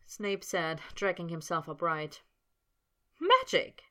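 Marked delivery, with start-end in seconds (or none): 3.2-3.8 high, loud fierce "Magic?"; fierce demand. A narrator's reading. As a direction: insist fiercely on the word. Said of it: "quite fierce".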